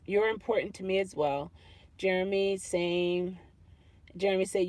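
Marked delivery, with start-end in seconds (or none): none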